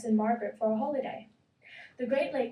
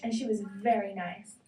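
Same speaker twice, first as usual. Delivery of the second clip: ordinary delivery, nothing marked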